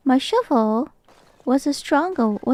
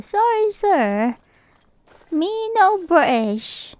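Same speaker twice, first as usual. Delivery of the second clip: high, slow, drawn-out mock apology; 6 semitones higher, slower than usual. Streamer denies being British to a viewer, playful high apology. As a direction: plead playfully in a raised, slowed voice.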